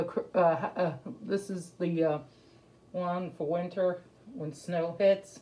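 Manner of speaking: muffled behind fabric mask; speaking with mouth covered; through a cloth mask. Real, not acted. Seen 2: cloth mask covers mouth and nose throughout the labelled words.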